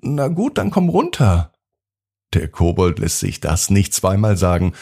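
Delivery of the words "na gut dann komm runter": high, surprised giving in; surprised yes, come down then. A narrator's reading. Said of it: "Das überrascht aber Yvonne".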